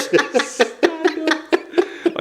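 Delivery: high, loud playful shout; mock rallying cry. Friends rallying the shorties, mock rallying cry. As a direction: holler it like a comic call to arms.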